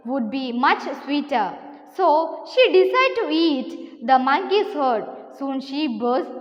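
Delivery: slow, deliberate classroom story reading; story read-aloud. A narrator's reading. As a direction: read it out slowly, phrase by phrase. (സാവധാനം കഥ വായിച്ചു കേൾപ്പിക്കുന്നു)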